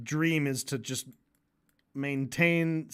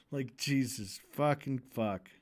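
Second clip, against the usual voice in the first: normal talking voice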